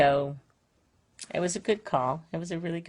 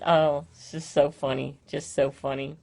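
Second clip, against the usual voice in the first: normal talking voice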